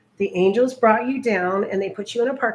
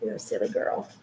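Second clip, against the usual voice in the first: whisper; 12 semitones lower, 7 dB quieter than usual. Real, not acted. Whispered take, then voiced.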